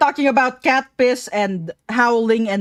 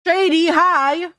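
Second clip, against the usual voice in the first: excited greeting; 6 semitones higher, slower than usual. Real, not acted.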